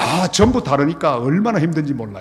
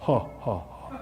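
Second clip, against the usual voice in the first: a hint of a dignified, soft-spoken pastor; lightly voiced solemn pastor with a polite chuckle; stiff Korean pastor; 6 semitones lower, 3 dB quieter than usual. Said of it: "보통 그러잖아요".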